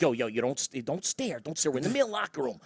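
low-volume, urgent father aside; retold discreet warning; hushed scolding. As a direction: tell him off under your breath. Father privately scolding staring son.

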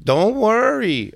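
high, slow, sing-song don't worry; mimicking her. Jay mimicking the woman's reassurance, high and slow. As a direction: drag it out blandly. Imitating woman's stock reply.

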